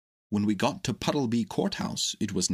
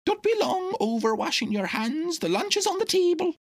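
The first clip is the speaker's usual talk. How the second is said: high, bustling duck voice, well above the narrator; a fussy housekeeper duck calling everyone to lunch; Dab-Dab the duck; 3 dB louder than usual. A narrator's reading. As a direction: duck housekeeper voice pitched much higher. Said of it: "said Dab-Dab".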